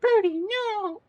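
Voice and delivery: very high, slow squeal of a cartoon girl; Ruby impression. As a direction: squeal it like a cartoon girl scolding her pet. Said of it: "Something like that".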